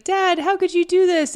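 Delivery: high-pitched mock-tearful plea; upset, crying. Retelling Charlie confronting his dad, mock-crying. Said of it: "He's, like, crying".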